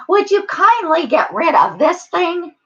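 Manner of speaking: high-pitched monkey voice; monkey request. A narrator's reading. Monkeys asking the eagle for help, high voice. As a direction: ask it high, with a pointed rise.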